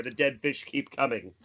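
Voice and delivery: ordinary talk, nothing marked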